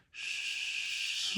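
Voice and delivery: soft voiceless drawn-out "sh-"; sh sound demo. Shown as a demonstration. Teacher isolating first step of sound, long soft "sh-". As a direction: hold a long, quiet "sh-" hiss without voice. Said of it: "start out with an S H".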